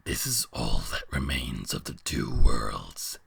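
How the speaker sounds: very low, distant beast voice; deep monster voice. Acted. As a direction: speak as a huge ancient beast. Beast explains.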